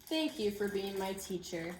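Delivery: gentle, expressive picture-book read-aloud; reading to children. Acted. Teacher reading a book to her class, storytelling voice. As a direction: tell the story so the children follow every page.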